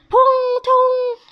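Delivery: high, loud splash noise; imitated crash. A narrator's reading. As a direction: voice a big storybook tumble into water. 模仿被撞落水的声音. (高声模仿扑通落水声)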